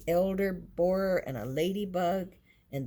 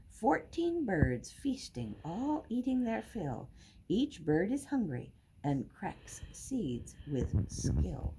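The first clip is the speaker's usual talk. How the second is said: ordinary delivery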